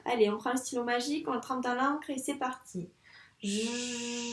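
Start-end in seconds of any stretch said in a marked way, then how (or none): 3.4-4.3 drawn-out jo, a bit softer; stretched syllable. Shown as a demonstration. Teacher writing jo in the air, drawn out. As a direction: trace jo in the air with your voice. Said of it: "écrire cette syllabe Jo dans l'air".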